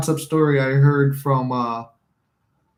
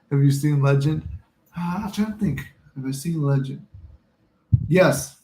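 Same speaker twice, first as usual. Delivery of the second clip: ordinary talk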